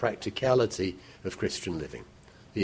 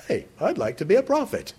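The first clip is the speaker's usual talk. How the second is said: mock eager volunteer; 8 semitones higher than usual. Mock eager would-be prophet.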